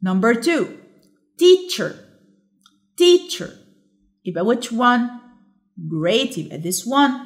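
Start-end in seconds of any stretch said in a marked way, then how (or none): 1.4-3.5 teacher with TEACH pitched high, then falling; correct first-syllable stress. Shown as a demonstration. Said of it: "which has the correct".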